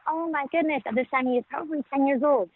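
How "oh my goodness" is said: higher, stunned exclamation; taken-aback mom. Retold or imitated. Mother reacting to her son's hopeless words, stunned and high. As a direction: hear your son's despair and catch your breath. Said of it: "And I was just like".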